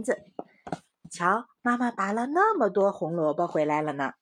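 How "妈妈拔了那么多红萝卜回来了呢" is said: ordinary delivery, nothing marked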